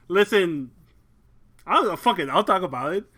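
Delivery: normal talking voice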